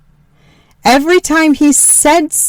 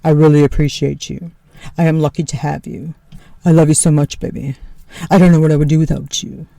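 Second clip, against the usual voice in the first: lower, earnest husband voice, lightly quicker at the end; imitating husband; 6 semitones lower, faster than usual. Wife repeating Tony's thanks, spot-on lower sincere voice. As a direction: copy his grateful tone, lower. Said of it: "That's actually exactly how he sounds".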